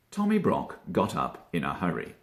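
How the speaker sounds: usual speaking voice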